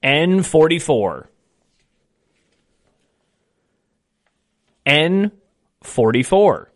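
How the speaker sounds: slow, deliberate number calling; bingo caller. Acted. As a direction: call each ball slowly with falling ends. Calls each bingo ball twice.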